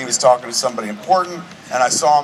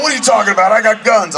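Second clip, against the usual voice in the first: higher-pitched imitation of a protesting friend; indignant protest; 7 semitones higher, 4 dB louder, faster than usual. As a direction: object eagerly, playing the offended friend. Retelling friend protesting he is fit.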